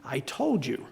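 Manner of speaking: soft whispered I-told-you-so. Retold or imitated. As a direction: whisper it smugly. Dad's gloating aside.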